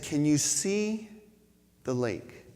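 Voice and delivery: a hint of a frail elderly woman, slow; lightly voiced dying woman, slow; lightly voiced old woman. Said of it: "she said".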